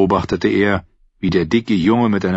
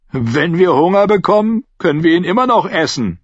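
neckend; 10 semitones higher, 4 dB louder than usual. A narrator's reading. Lark neckt Paul.

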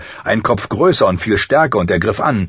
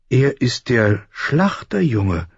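Sansa's voice in narration; 5 semitones higher, slower than usual. A narrator's reading. Sansa remarks on the boy.

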